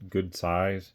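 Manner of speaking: ordinary talk, nothing marked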